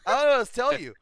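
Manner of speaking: high, loud, hammed-up rant; exasperated retort. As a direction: snap back loudly at a nagging passenger. Retold argument with passenger.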